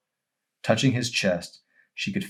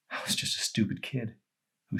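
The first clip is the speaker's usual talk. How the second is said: whispering; 4 semitones higher, 7 dB quieter than usual. Acted. Arson confessing in shame.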